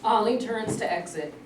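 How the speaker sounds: normal talking voice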